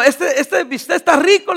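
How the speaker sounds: chuckling through the words, slow; laughing. Retold or imitated. Pastor joking with friends at dinner, laughing. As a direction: laugh it out while savoring the joke.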